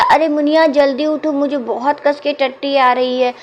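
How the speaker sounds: put-on a small boy urgently waking her; urgent child call. A narrator's reading. Child wakes sleeping Muniya at night, in a high urgent call. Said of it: "तो वो बच्चा बोला".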